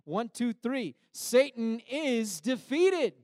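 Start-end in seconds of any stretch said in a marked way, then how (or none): 1.1-3.1 Satan is defeated, shouted high and slow; loud declaration. Shown as a demonstration. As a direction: call out Satan is defeated slowly and loudly. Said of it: "would you declare this with me?".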